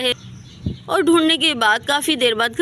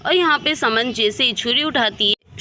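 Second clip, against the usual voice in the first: ordinary delivery, nothing marked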